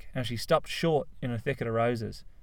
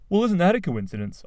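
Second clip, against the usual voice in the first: high, loud, gushing; eager Cliff; 7 semitones higher, 5 dB louder than usual. A narrator's reading. Cliff gushing to his parents about meeting Rose, eager. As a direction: tell it like a wonder.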